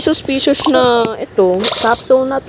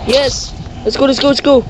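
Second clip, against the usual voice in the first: excited cheer; 3 semitones higher than usual. Real, not acted. Cheering a win.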